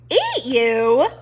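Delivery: loud disbelieving echo; incredulous. Acted. Porcupine scoffing at Poppy, loud. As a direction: throw it back in disbelief.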